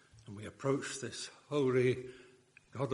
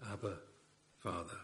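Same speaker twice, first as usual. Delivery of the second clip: soft, intimate call to a father; hushed tender cry; 4 semitones lower, 12 dB quieter than usual. Acted. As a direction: breathe it like a child to its father.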